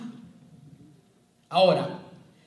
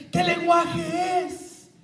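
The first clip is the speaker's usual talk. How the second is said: slow, loud, higher puzzled call; puzzled exclamation; 11 semitones higher, 6 dB louder, much slower than usual. Retold or imitated. Listener hearing tongues for the first time, amazed. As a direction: ask it drawn out in amazement.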